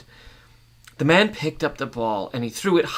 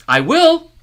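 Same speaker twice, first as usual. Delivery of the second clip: angry retort; 11 dB louder than usual. Acted. Man answers threat.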